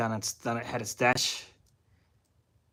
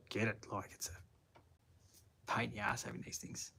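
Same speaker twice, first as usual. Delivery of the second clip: whispered aside; 13 dB quieter, slower than usual. Real, not acted. Muttered aside while reading chat.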